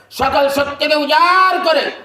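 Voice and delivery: building to a loud, high, long-held cry; rousing shout. Real, not acted. Preacher urging the congregation to cry out praise, rousing shout. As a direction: shout the praise with all your strength, stretching the final word. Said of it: "চিৎকার করবে".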